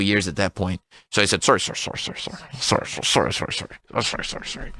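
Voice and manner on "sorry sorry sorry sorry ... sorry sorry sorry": repeated sorry whispered, trailing to nothing; whispered sorries fading. Retold or imitated. Husband begging wife for forgiveness, whispered and fading. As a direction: whisper the apology over and over, fading away. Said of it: "apologizing, unvoiced, fading".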